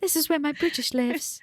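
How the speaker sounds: high-pitched playful British voice; British accent up high. Shown as a demonstration. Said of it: "if I go up here".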